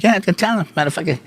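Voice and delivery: a hint of a sneering New York tough guy; high mock snarl at the pro; imitating Joe Pesci. Joe Pesci voiced in retold fight.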